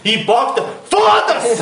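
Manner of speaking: high mock-furious yell with laughter in it; retold hateful outburst. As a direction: hurl it as an exaggerated, laughing insult. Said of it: "o do ódio rendeu mais".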